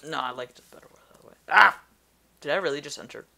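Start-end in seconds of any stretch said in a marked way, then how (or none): 1.5-1.8 startled yelp. Real, not acted. Accidentally hit enter in menu.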